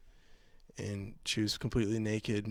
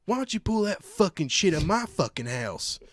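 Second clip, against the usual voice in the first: mock angry yell; 10 semitones higher, 4 dB louder than usual. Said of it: "And then he's like".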